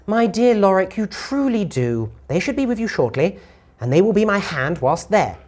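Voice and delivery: high-pitched, condescending lady; distorted female voice. Acted. Lady answers Lorik's refusal, high and condescending. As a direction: play a haughty lady talking down in a high voice. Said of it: "uh, female, but it sounds distorted".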